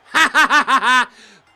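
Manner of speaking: big triumphant burst of laughter; loud laugh. Real, not acted. Loud laugh after win.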